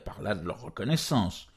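plain reading voice

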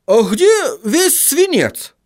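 high, drawn-out dwarf voice; high-pitched, puzzled dwarf character; bewildered dwarf. Acted. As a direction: ask it high and slow, baffled. Dwarf finds the lead gone.

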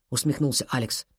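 ordinary delivery, nothing marked

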